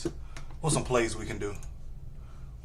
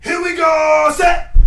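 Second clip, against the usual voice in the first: high, loud drawn-out snap count; quarterback cadence shout; 14 semitones higher, 9 dB louder, much slower than usual. Pretend. Player calling cadence before a throw, high drawn-out shout. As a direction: holler it long and high like a quarterback.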